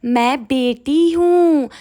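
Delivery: lightly voiced child, drawn-out words; little girl's protest, imitated. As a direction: imitate a small girl insisting, slowly. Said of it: "तो बोल रही है कि".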